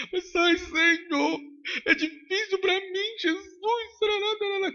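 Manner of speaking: high, slow, crying mock whine; preacher mimicking a believer whining that obeying is hard; mock whimpering. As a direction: ham up a tearful complaint to Jesus.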